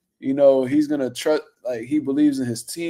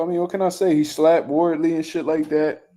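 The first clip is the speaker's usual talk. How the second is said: ordinary talk, nothing marked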